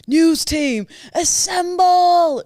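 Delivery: drawn-out booming rally cry; Anchorman shout. Retold or imitated. Quoting Anchorman to a co-host, a slow loud rallying shout. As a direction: bellow it like Ron Burgundy. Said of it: "on Anchorman where he goes".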